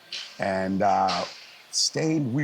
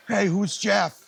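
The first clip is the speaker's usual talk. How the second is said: calling out; 10 semitones higher, slower than usual. Retold or imitated. Bartender calls for Jeff across the bar, mock call. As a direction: hail someone in a noisy room.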